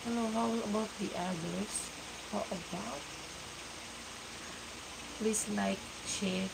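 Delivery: normal talking voice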